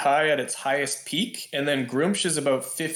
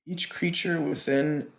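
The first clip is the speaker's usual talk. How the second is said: ordinary talk, nothing marked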